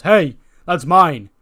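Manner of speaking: a hammed-up heel claiming his belt; Kevin Owens yelling. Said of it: "He goes".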